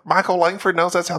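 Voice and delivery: ordinary talk, nothing marked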